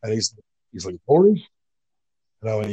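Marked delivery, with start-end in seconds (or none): 1.1-1.5 high, louder disbelieving question; retold startled double-take; incredulous questioning. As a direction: imitate a boss who can't believe who it is. Imitates boss stunned at his look.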